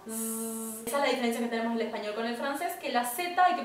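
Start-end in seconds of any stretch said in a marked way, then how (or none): 0.1-0.9 soft buzzing zzz; bee buzz. Shown as a demonstration. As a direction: buzz softly like a little bee. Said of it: "haciendo una abejita".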